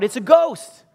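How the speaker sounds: high, loud cry of terror; fearful cry. Acted. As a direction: cry out in acted fright. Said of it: "they cried out".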